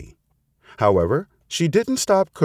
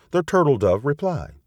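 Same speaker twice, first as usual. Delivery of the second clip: usual speaking voice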